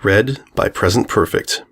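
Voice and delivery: usual speaking voice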